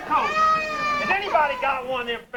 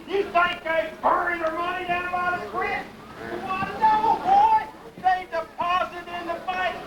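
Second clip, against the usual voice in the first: Pa lecturing his backward son; scolding hillbilly Pa; 8 semitones higher than usual. Acted. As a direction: lecture the boy like he's a fool.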